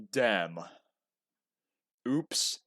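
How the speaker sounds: ordinary talk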